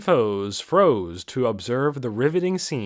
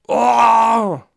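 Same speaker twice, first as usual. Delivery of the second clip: much higher, far louder hoarse battle cry; hoarse ogre shriek; 10 semitones higher, 11 dB louder than usual. Acted. Ogre facing Ainz, hoarse shrieking battle cry. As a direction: bellow it like a panicked monster charging. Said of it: "raised a hoarse voice in what could have been either a shriek or a battle cry".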